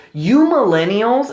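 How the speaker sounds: a mild hammed-up snobby lady; scornful older woman. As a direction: sneer it like a disapproving lady.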